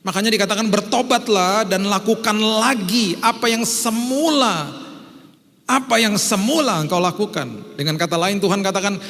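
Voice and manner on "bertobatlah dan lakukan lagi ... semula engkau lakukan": slow, raised voice of God rebuking. Acted. Preacher voicing God to the church, slow and raised. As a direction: boom it out as God's rebuke.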